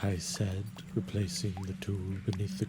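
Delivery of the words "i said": usual speaking voice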